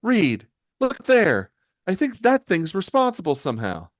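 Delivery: high-pitched, urgent heroine voice; Sue. Acted. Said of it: "Sue points towards the box attached to the ceiling and says".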